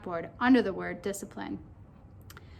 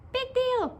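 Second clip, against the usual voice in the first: very high, squeaky little voice; high-pitched cartoon pigtail; 13 semitones higher than usual. A narrator's reading. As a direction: squeak it like a tiny talking pigtail. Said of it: "said the pigtail".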